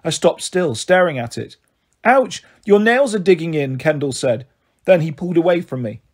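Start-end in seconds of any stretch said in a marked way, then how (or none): 2.0-3.8 higher-pitched cry of pain; pained protest. A narrator's reading. Child to sister gripping too hard, pained protest. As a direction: yelp it as a mild complaint.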